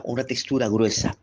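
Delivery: ordinary talk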